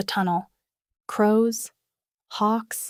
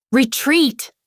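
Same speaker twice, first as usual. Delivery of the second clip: higher, loud panicked order; screamed; 6 semitones higher, 7 dB louder than usual. A narrator's reading. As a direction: scream the retreat. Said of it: "someone screamed".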